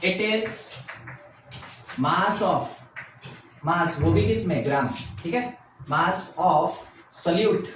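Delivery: very slow, word by word, dictating notes to the class; unhurried classroom dictation with repeats. Shown as a demonstration. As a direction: spell it out slowly so students can write. Teacher dictating definition for notes.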